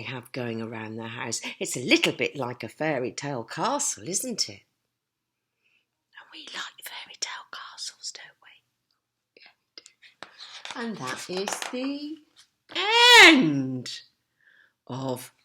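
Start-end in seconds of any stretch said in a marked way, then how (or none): 6.1-9.9 whisper. Real, not acted. Whispering to child.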